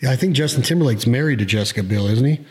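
ordinary talk

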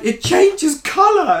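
high, loud, drawn-out mock wonder; exaggerated awestruck voice, sending them up; mock amazement. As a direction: imitate gushing tourists, slow and high. Mocking amazed people, "They're like.

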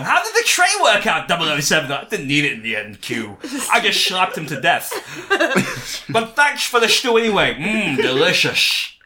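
hammed-up high, loud Q voice; mock Q voice. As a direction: play the fussy gadget man, laughing. Acting out Q and Bond.

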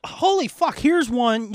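ordinary talk

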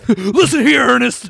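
loud high barking yell; mock drill sergeant. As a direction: bellow it like a boot camp sergeant. Mock gruff prison guard.

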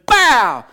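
loud, booming "bow" like a crash; explosive impact noise. Retold or imitated. Speaker acts out his high school tackle, explosive crash. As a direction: shout out the hit as a sound effect. Imitated impact while telling story.

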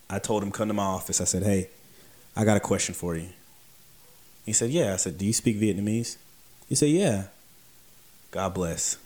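usual speaking voice

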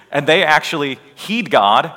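normal talking voice

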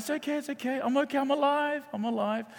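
very high-pitched reassurance; imitated consoling. Sister reassures crying friend she is alive, high-pitched consoling.

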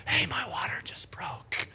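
slow, breathy imitated whisper; mock whisper. Husband mimicking wife's calm news, in a whisper. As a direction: whisper it far too calmly for the news. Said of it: "the tone of that did not match".

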